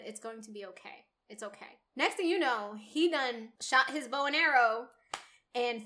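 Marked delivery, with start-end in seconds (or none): 0.0-1.8 much softer and quick, a calm hushed reassurance; lightly voiced reassurance, softly; soothing reassurance. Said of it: "he said".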